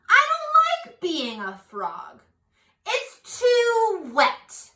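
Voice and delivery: high, slow, drawn-out whine; whiny frog complaining. Acted. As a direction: stretch the words like a sulky little frog. Frog complains in read-aloud.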